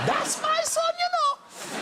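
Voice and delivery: very high-pitched imitated mother; proud mum shrieking. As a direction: squeal it out to the whole audience. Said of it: "she'd be kind of".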